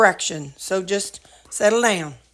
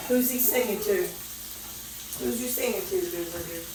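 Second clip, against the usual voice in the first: soft to dog; 3 semitones lower, 11 dB quieter than usual. Real, not acted. Talking to dog softly.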